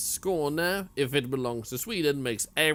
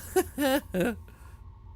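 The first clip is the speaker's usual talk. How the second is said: laugh; 12 semitones higher than usual. Real, not acted. Laughs at Paloma's line.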